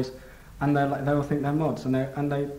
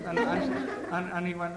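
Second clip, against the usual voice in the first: ordinary delivery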